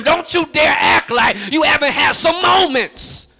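fiery preaching, building to a shout; rising sermon rebuke. Real, not acted. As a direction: preach it harder and harder, running the phrases together. Preaching builds to full force.

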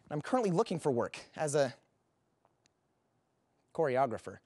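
ordinary delivery

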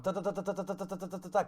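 soft rapid ta-ta-ta shots; imitated gunfire. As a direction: rattle off toy-gun noises. Shooting at Mario monster.

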